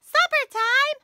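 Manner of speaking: excited surprise. Acted. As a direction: jump at the idea of supper. Puppet mishears excitedly.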